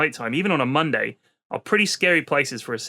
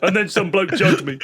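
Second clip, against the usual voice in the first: prisoner voice; 4 semitones higher, 4 dB louder than usual. Pretend. Joke continuing prisoner voice.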